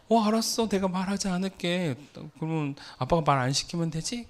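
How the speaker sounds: high-pitched, retold fatherly giving-in; exasperated placating. Dad promising his crying daughter to stop talking, exasperated and placating.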